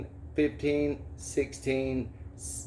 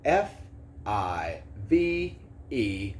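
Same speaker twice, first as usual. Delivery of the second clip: letter by letter, slowly; slow, drawn-out spelling for kids; 3 semitones lower, much slower than usual. Shown as a demonstration. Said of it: "touch under each letter and spell".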